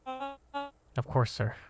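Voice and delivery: high soft reply; meek servant voice. Acted. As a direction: answer timidly. [name] answers meekly.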